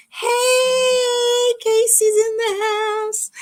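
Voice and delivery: high, squealing hello to a friend; excited greeting. Real, not acted. Greeting Casey as she joins the live chat, squealing with delight. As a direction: call it out like she just walked in.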